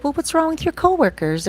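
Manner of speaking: high, lightly voiced girlfriend asking what's wrong; girlfriend's voice. Girlfriend voiced in retold story.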